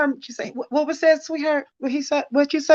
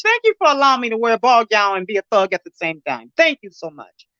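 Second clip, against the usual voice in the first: ordinary talk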